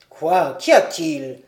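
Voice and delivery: voix grave et forte de vieux médecin; un docteur bourru qui accourt; vieux docteur surpris. A narrator's reading. (le docteur répond à l'appel, voix grave et forte)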